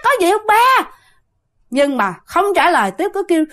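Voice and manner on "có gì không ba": high, fast, fed-up call back; exasperated reply. Retold or imitated. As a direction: snap back at a dad who won't stop calling. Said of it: "bạn nói". (con gái bực bội gọi vọng lại, giọng cao và nhanh)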